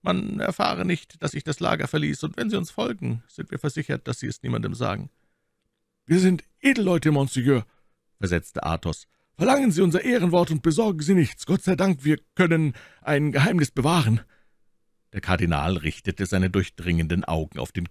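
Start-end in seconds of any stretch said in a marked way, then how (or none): 0.0-5.1 secretive cardinal. A narrator's reading. Cardinal instructing his squire, hushed and lightly voiced. 6.1-14.2 lightly voiced Athos, courteous and higher; polite musketeer. A narrator's reading. Athos apologising to the unknown officer, lightly voiced.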